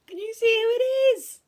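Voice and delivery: high, loud, quick question; anxious urgency. A narrator's reading. Friends quiz Owl at the peephole, anxiously urgent. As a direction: ask in an urgent rush.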